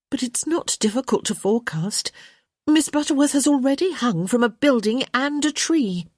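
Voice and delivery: put-on high-pitched young woman explaining; exasperated young woman. A narrator's reading.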